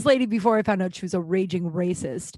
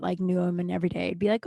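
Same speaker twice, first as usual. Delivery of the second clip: usual speaking voice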